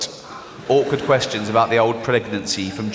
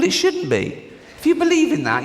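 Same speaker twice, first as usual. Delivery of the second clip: high indignant retort; 13 semitones higher than usual. Real, not acted. Mock outrage at critic.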